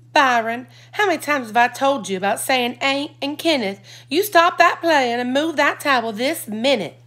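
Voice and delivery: scolding. A narrator's reading. Mother scolding her son.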